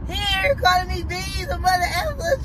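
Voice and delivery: high-pitched imitation of a child telling on someone; mock tattling. Kid telling mom on his brother, mock tattling. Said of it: "trying to tell on them".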